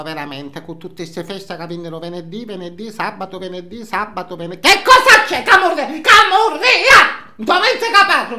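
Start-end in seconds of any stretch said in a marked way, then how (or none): 4.7-8.4 sudden shout. Real, not acted. Yelling at someone off-screen.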